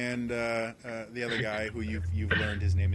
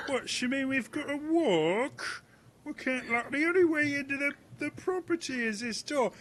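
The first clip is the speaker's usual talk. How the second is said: much higher, protesting whine; high complaining young guard; whiny Gareth. Acted. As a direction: whine about having to walk. Gareth voiced by GM.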